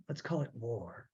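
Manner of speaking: low and hushed, dead serious; low grim quote. Shown as a demonstration. Host repeats the closing line, low and hushed. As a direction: drop it low and quiet like a verdict.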